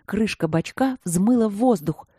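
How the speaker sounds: ordinary delivery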